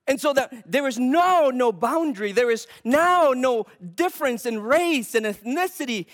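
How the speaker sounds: loud, high, driving sermon voice; preaching at full force. Acted. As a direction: preach it hard to the congregation. Preaches on Gentiles sharing the faith.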